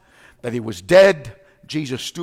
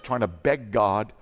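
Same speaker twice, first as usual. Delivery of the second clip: ordinary talk, nothing marked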